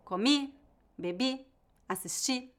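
"Comi, bebi, assisti" as careful examples, leaning on each final i; final-syllable stress. Shown as a demonstration. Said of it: "têm a tônica na última sílaba".

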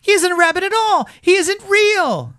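very high, loud rabbit accusation; scandalised high-pitched outburst; exclaiming. A narrator's reading. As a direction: denounce him in a squeaky high rabbit voice. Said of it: "he exclaimed".

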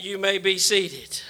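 normal talking voice

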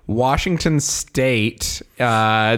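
ordinary talk

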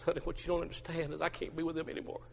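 hushed, hurried confession; acted dejection. Peter explaining himself to his wife, quietly dejected. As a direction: murmur it softly, defeated. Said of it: "Peter just begins to weep".

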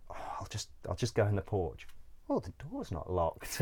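hushed, secretive whisper that fades into a laugh; sneaky whisper. Retold or imitated. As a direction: whisper as if creeping into a church at night. Sneaking into a church porch.

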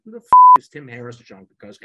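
loud, fast retold outburst; exasperated outburst. Retelling his outburst at being passed on, loud and fast. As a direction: blurt it out, fed up.

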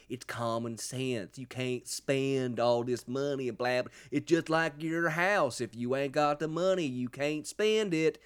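slow, exaggerated Southern drawl; mock an idiot's rant in a Southern accent; mock screeching politician. Host imitates politician going on about spending, in mock Southern ranting. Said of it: "he was always screeching".

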